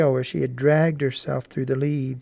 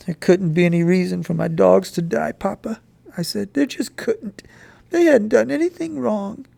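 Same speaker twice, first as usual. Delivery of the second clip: put-on a lightly raised, earnest boy's voice; boy asking mother to carry his dog home; pleading boy; 6 semitones higher, 4 dB louder than usual. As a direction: plead to take her home. Boy argues with father.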